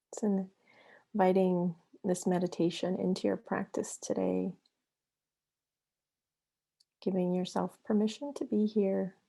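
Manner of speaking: unhurried meditation guide with long pauses between phrases; guided meditation. Acted. Yoga therapist guiding listeners into breath and flow, guided meditation. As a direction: lead them into the breath, leaving long silences.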